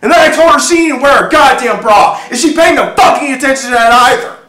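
explosive high, loud shouting; enraged screaming. Acted. Humiliated husband raging that his wife laughed at him, screaming. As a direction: erupt and keep yelling at full pitch. Acted rage erupts at being laughed at.

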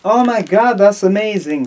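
high, loud, hammed-up gushing; mock delighted. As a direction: gush over a gift in a skit. Acts the gift receiver in skit.

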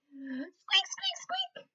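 tiny whispered high squeaks; mouse squeak. Acted.

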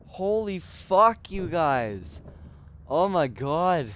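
ordinary delivery

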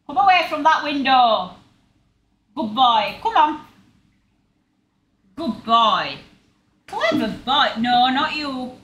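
loud praise and commands to a dog; loud, high-pitched dog talk. Real, not acted. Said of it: "You speak too loud".

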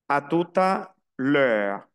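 "A tout à l'heure" slowly, as "a tu-ta lö"; slow French model, "tout à" run together; linked words. Shown as a demonstration. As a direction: join "tout à" into "tuta". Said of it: "Aquí se une".

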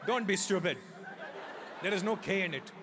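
the indignant Indian dad, laughing it out; dad's Indian accent. Retold or imitated. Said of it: "give everything an accent".

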